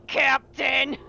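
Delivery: usual speaking voice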